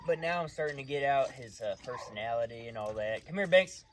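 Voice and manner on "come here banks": loud, high call to the dog. Real, not acted. Trainer calling his dog Banks, loud and high. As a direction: call Banks over across the yard.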